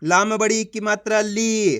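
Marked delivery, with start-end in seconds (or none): 1.2-1.8 ली with the ee drawn out; teacher's drawn-out ली; stretched long ee. Shown as a demonstration. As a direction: hold the vowel in ली, then let it fall. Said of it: "खींच के पढ़ा जाता है".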